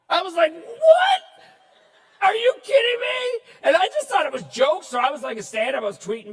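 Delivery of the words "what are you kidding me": high, loud incredulous yelp; stunned disbelief. Retold or imitated. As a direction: shout it like you can't believe what you just read. Shocked by hate tweets.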